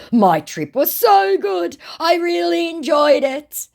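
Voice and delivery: high, loud, slow put-on gush; mock shout. Woman reading the scammer's lie about his trip, in a high mock shout. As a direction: gush it like a fake tourist.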